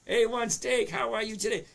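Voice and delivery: high, loud cheerful hello; excited greeting. Real, not acted. Host greeting a livestream viewer by handle, loud and cheery. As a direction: call out to a viewer you're happy to see.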